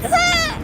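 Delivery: mock scream of a fleeing Pokemon. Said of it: "He's just screaming at me".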